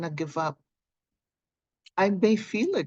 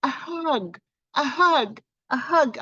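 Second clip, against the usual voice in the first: offering hugs; 6 semitones higher, 4 dB louder than usual. Real, not acted. Sending hugs to viewers.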